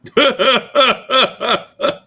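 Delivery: big high burst of laughter; loud laugh. Real, not acted. Co-host reacting to the angry woman's clip, loud helpless laugh.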